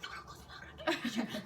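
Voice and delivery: unvoiced urgent plea; whispering to a friend not to close the locker; frantic whisper. Begging friend while hiding.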